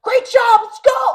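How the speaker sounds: high, loud encouraging shout from the sideline; cheering yell. Retold or imitated. Coach cheering the kids from the sideline, high and loud. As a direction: pump the team up like a coach. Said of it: "When he was yelling".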